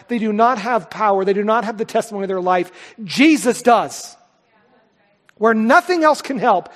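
loud, driving preacher's cadence; preaching at full force. Acted. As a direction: hammer home that the thieves cannot save. Preaching on the thief.

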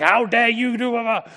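loud, high mock outrage; hammed-up telling-off; mock scolding. Imagined father scolding his returning son, mock outrage. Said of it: "He does not scold him, like".

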